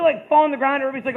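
ordinary talk, nothing marked

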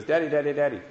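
put-on a little boy bursting to ask his daddy; excited little boy. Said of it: "he's all excited".

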